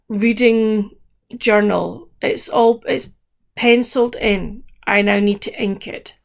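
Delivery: normal talking voice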